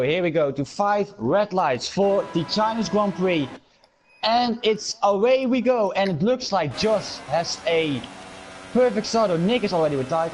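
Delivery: motorsport commentator. Acted. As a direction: race start call, drawn out. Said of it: "do I have the honor to start".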